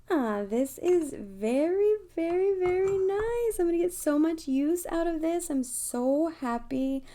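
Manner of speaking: high, loud, gushing delight; thrilled. Real, not acted. Showing viewers her new box, thrilled and gushing. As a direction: rave about the gift box, beaming.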